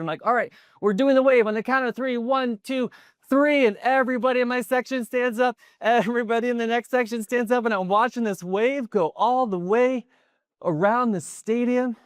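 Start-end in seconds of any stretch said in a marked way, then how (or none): 0.2-3.7 high, loud rallying call; excited count-off. Retold or imitated. Kid leading section into the wave, excited high shout. 3.7-12.0 very high, swept-up narration; thrilled climax. Retold or imitated. Speaker watching the wave circle stadium, elated.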